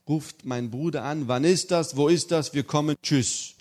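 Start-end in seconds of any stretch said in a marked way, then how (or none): 1.3-3.5 louder, higher, brusque caller; brother's abrupt phone call, loud and curt. Retold or imitated.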